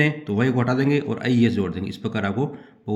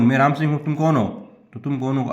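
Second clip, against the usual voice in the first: ordinary talk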